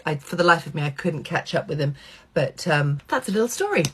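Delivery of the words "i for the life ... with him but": usual speaking voice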